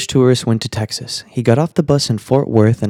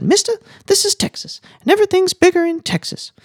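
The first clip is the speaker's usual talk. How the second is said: very high-pitched waitress voice; squeaky-high joke character explaining to a customer; Texan waitress. Acted. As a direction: put on a high falsetto for the waitress. Waitress repeats her answer.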